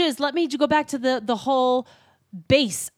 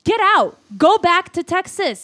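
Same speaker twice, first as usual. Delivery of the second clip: angry shout; 7 semitones higher, 3 dB louder than usual. Acted. Kicking out rude customer.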